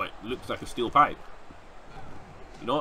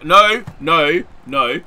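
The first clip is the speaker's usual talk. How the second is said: loud, higher repeated protest; alarmed shouting; 4 semitones higher, 11 dB louder, much slower than usual. Real, not acted. Gamer watching the match go wrong, alarmed shouting. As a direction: shout each one in protest.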